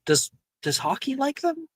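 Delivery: put-on light high voice for the girl; mock-girlish high ask; high-pitched question. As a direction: lift it up high and curious. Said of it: "I think she's like".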